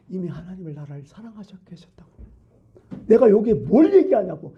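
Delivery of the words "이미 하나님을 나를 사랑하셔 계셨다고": whisper. Real, not acted. Preacher drops to a whisper.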